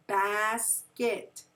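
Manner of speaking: "basket" drawn out as two parts, bas-ket; split into syllables. Shown as a demonstration. Teacher has pupils feel the chin drop, "basket" split in two. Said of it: "Where did it separate?".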